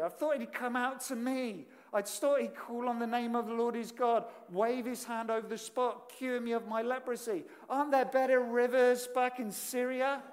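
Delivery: loud, wounded pride boiling over; enraged Naaman. Retold or imitated. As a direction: huff like a slighted general. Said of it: "He's in a rage".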